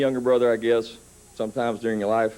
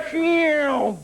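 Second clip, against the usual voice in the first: whoosh; 13 semitones higher than usual. Retold or imitated. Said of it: "Doug went".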